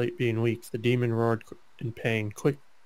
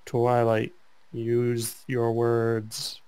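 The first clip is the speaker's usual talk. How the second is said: slow drawl; 3 dB louder, much slower than usual. Pretend. Reader drawls the fanfic line.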